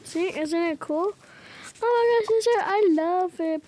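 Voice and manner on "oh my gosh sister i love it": play-acted delight. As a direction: gush over a sister's trick, hammed up. Acted sister admiring a trick.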